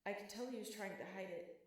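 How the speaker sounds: usual speaking voice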